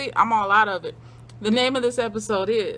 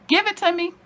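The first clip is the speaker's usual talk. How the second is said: mock eager demand; 8 semitones higher, 5 dB louder, faster than usual.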